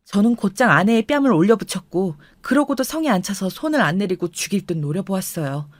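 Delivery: usual speaking voice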